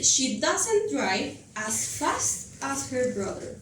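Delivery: slow, careful reading of the English sentence; deliberate classroom example. Shown as a demonstration. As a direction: read it out slowly for the class. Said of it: "teacher reads English example slowly".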